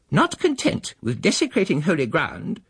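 indignant spinster. Acted. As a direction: play a shrill-tempered churchgoing woman, affronted. The angry female speaks.

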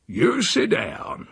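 high, drawn-out rustic order; insistent old uncle. A narrator's reading. Uncle stops his nephew fetching wine, insistent.

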